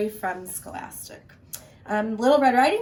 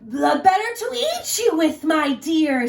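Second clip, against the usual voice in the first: loud high snarl; wolf about to pounce; 7 semitones higher, 7 dB louder than usual. Acted. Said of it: "snarled the wolf".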